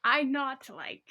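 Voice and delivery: drawn out, each word weighed; slow deadpan repeat. Shown as a demonstration. To her cohost, mocking the review, slow and deadpan. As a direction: savor the broken English slowly. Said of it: "repeats the review line slowly".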